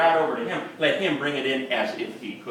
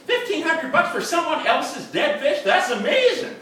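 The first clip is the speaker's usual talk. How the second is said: high-pitched, mounting disbelief; incredulous amazement; 10 semitones higher than usual. Acted. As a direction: play up the astonishment, pitched well above normal. Acts out disbelief to his wife.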